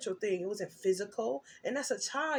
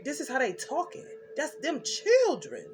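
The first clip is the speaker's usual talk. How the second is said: ordinary talk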